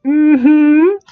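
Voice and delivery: squeaky high hum of approval; a hammed-up high mm-hmm; high little hum, mock coy. Character's only reply.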